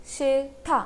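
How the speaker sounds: शिथा split as शिल, then था; pronunciation demo. Shown as a demonstration. Teacher shows the first example, sounding out शिथा by syllable. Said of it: "it will be pronounced as only".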